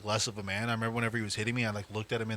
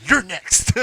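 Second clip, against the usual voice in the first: loud, high imitation of mom's threat; mock menacing shout; 7 semitones higher, 10 dB louder than usual. Mom's threat acted as comedy skit.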